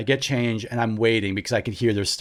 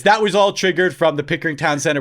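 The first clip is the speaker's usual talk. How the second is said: usual speaking voice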